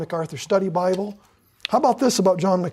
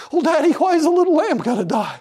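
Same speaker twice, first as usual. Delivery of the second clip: a lightly voiced small child, higher and pleading; child asking; 7 semitones higher than usual. Son asking his daddy about the lamb, lightly voiced small child. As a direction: ask like a puzzled little boy. Child asks father in retold scene.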